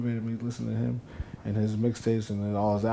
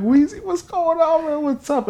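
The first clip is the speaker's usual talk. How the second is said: very high, louder mock-excited call; excited greeting; 7 dB louder, slower than usual. Greeting [name] as he runs up, excited. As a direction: hail your idol in a squeal.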